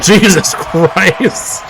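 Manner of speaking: a drawn-out gasp of disbelief at the hit; stunned exclamation. Real, not acted. Commentator watching a brutal whiplash, slow stunned shock.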